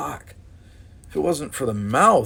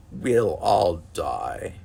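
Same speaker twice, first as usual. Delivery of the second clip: solemn; 4 semitones lower, much slower than usual. A narrator's reading. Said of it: "Odril nodded solemnly".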